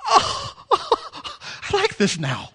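squealing with high-pitched delight and a laugh; delighted discovery. Acted. Former drinker tasting root beer, thrilled and laughing. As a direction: gush over the first sip.

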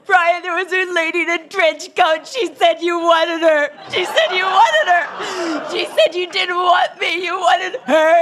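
hammed-up tearful shouting, high and loud; hysterical wail. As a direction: blurt it all out in a mock meltdown, high-pitched. Retold hysterical confession to husband.

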